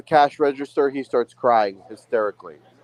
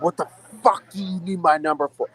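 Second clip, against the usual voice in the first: retold screaming; 7 semitones higher, 4 dB quieter than usual. To the phone salesman at Target, a retold higher-pitched yell. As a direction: replay the blowup at the salesman, pitched up. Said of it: "I start screaming at him".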